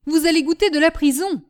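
loud, high angry threat; crying out. Acted. Master grabbing the escaping servant, crying out. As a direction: shout a threat at the fleeing servant. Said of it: "s'écria monsieur Delmy".

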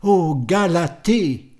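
slow, loud cry of joy; a lover's ecstatic outcry; joyful cry. Acted. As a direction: call out the name in rapture. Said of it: "s'écria".

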